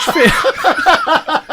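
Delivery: "Easier to catch fi-" breaking down into laughter, very slow; corpsing mid-punchline, slow and high; laughing. Acted. Comedian cracking up in the polar bear's punchline.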